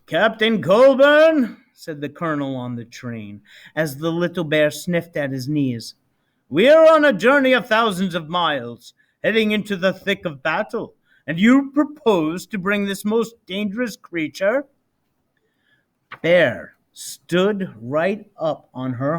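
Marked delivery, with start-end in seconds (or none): 0.0-1.6 slow, loud officer's call; commanding officer addressing a captain; stern colonel. Acted. 6.5-14.6 louder, disapproving officer; officer objecting to a bear; stern colonel. Acted. Colonel scolding.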